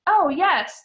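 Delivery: loud, high, overdone yes; hammed-up false agreement; lying. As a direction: fake enthusiasm. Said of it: "they lied".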